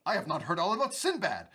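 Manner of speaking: ordinary delivery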